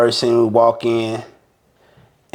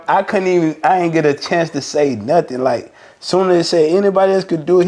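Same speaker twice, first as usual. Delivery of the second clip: usual speaking voice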